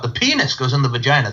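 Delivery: "penis" punched with a jump in pitch; stress on PENIS. Retold or imitated. Host imitating his health teacher, heavy stress on "penis". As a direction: imitate a teacher leaning hard on the word "penis". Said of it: "the woman had the emphasis on the word penis".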